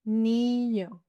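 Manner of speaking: NIÑO with a long, level "ee"; slow "Niño", first vowel held; drawn-out demonstration. Shown as a demonstration. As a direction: stretch NIÑO slowly for learners. Word drawn out, then normal.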